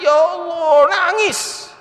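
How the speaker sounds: high mock cry of shock. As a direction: cry out high, as if bursting into tears. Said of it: "si perempuan tadi itu jerit". (istri menjerit kaget lalu menangis, dibuat-buat tinggi)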